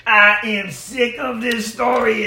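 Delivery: hammed-up exasperated cry; exasperated outburst. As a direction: burst out fed up, in a comic way. Stoogie fed up with the story.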